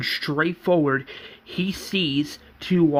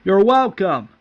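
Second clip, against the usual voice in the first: raised, higher call; retold scream; 5 semitones higher, 7 dB louder than usual. Fighter answers thanks mid-battle, calling out loudly. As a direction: call it out loudly, imitating a yell. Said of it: "Morpheus screams saying".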